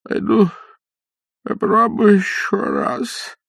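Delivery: put-on high, slow quavering old man; old man's voice. A narrator's reading. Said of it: "со словами".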